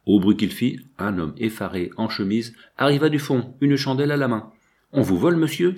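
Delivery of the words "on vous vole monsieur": higher, urgent warning cry; exclaiming. Acted. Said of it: "s'écria l'abbé". (exclamation d'alerte, voix plus aiguë)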